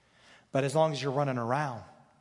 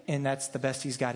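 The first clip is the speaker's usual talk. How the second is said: ordinary delivery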